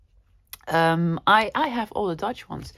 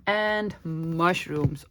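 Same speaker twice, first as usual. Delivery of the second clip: ordinary delivery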